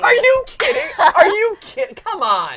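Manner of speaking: high, loud outraged cry; disbelieving shout. Real, not acted. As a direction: yell in shocked disbelief. Hears eighteen movies, protests.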